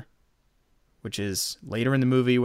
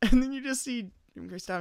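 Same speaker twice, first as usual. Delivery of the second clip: ordinary talk, nothing marked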